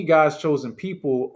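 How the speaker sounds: normal talking voice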